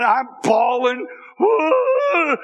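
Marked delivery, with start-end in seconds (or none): 1.4-2.4 dying moose wail. Retold or imitated. Panicked boy bawling while prying the door. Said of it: "Sound like a moose that's dying".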